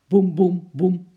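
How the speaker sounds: loud, evenly spaced booms; imitated booming thumps. A narrator's reading. Storyteller imitates an apple bouncing downstairs, loud thuds. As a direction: drop each boom like a step.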